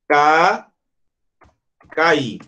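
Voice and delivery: "cair" broken as ca, then ca-IR; separated vowels. Shown as a demonstration. Teacher gives an accent-rule example, separated vowels in "cair". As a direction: hold "Ca", pause, then say "cair" in two syllables. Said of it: "porque separou do A".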